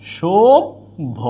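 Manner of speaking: অসভ্য drawn out slowly; slow demo pronunciation. Shown as a demonstration. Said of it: "teacher demonstrates pronunciation".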